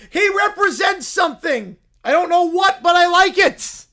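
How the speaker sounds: loud, indignant bear; indignant shouting. Acted. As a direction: shout down the general. Bear defends Jar Jar.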